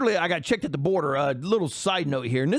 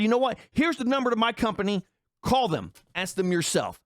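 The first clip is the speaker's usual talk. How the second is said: retold angry brush-off; 7 semitones higher than usual. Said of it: "I lost my shit".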